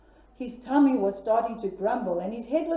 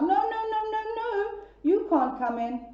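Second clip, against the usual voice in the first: piggy refusal; 9 semitones higher than usual. Acted.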